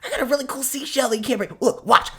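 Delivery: fast, high and eager; excited kid. Acted. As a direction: gush like a thrilled child. Lily excited about seashell.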